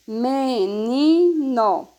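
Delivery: "Menino" very slowly split as me, ni, no; teacher's drawn-out "Me... ni... no", a beat on each syllable. Shown as a demonstration. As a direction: stretch "Menino" into three separate syllables. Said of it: "três sílabas".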